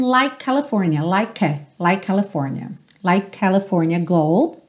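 "like" K merged into "California"; one K sound joining "like" and "California"; shared K sound. Shown as a demonstration. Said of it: "o E não é pronunciado".

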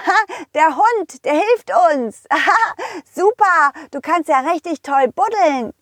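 put-on high, giggly little boy; gleeful child voice with giggles; delighted little pirate. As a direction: play an excited kid cheering a digging buddy.

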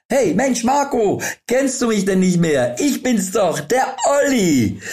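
high-pitched Olli voice. Acted. Masked Olli greets Marco on the street, high and eager. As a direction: play the pushy acquaintance chirping high.

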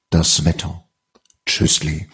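lightly put-on radio presenter; a hint of a smooth radio host. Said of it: "so eine ganz tolle Radiostimme zu haben".

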